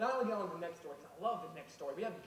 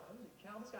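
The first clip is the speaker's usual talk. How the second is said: stunned hush; 10 dB quieter, faster than usual. Acted. Pharaoh stunned by Moses.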